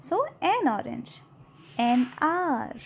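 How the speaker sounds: so an orange stretched out very slowly; slow teacher's model reading; slow demo. Shown as a demonstration. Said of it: "ओ का उच्चारण हो रहा है".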